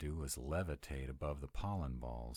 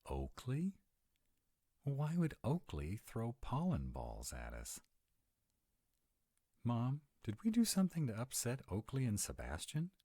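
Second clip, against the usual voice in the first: alarmed surprise; 9 semitones higher than usual. Acted. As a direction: pitch jumps way up in disbelief. Realizes Oakley may be behind it.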